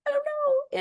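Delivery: very high, choked-up helpless reply; a lost, teary I don't know; tearful I don't know. Retold or imitated. Sobbing in the bar when her cousin asks, tearful. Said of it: "And I'm like".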